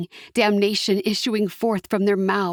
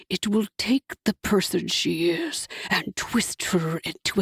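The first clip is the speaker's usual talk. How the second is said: a devil pressing for the dagger; low devil voice; 4 semitones lower than usual. A narrator's reading. Hasatan's voice.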